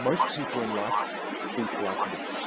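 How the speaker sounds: low, slow and hushed; measured, soft, low-voiced mentor; teacher's profound lesson. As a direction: deliver a grave secret softly. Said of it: "She says".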